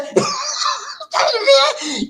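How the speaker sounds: bursts into a loud, high shrieking laugh; laughing hard at his own joke. Real, not acted. Laughs after acting angry wife.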